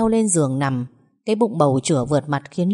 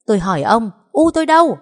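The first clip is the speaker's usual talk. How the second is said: high, loud yell at her father; piercing yell; 5 semitones higher, 6 dB louder, faster than usual. A narrator's reading. Daughter screaming at her father for her mother. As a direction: scream the demand at him. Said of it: "tiếng gào chát chúa".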